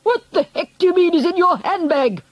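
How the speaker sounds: high, blustering shout; indignant shout. A narrator's reading. Angry father shouts at the grandmother, indignant shout. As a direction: shout it in outraged disbelief. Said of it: "Mister Jenkins shouted".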